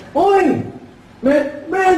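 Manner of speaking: high mock nasal greeting; excited ngongo hailing a pal; ngongo son. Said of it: "Pagpunta ng anak ng ngongo".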